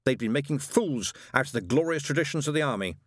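plain reading voice

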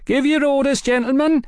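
waiter shouting. Acted. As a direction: call out orders over the crowd. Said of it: "a young waiter now shouted".

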